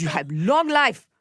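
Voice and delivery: put-on slow, lightly accented doctor; slow doctor's blessing to the patient; imitating the Korean doctor. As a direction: play the kind doctor, slowly. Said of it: "I'm imitating her".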